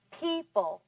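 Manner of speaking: "People" with strong PEE, weak second syllable; stress on first syllable. Shown as a demonstration. Teacher models the word for class, "People" stressed on the first syllable. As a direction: punch the first syllable of "People", let the second fall away. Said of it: "On the first syllable".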